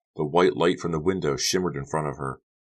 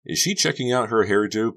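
raised, bitterly incredulous question; bitter thought; 7 semitones higher, 4 dB louder than usual. A narrator's reading. Becca watching Honey at the mirror, bitter thought. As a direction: think it with resentment. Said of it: "Becca thought bitterly".

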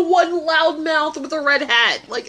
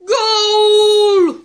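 loud, higher stadium-style goal cry; goal shout; 5 semitones higher, 6 dB louder than usual. Pretend. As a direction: yell it like a commentator at the final. Shouting a football goal call.

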